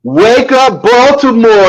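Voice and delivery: high, ringing morning radio greeting; radio wake-up call. Shown as a demonstration. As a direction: belt it out like a morning DJ. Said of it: "I can't say it like you, Mike".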